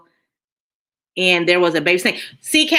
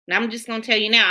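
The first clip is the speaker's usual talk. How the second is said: normal talking voice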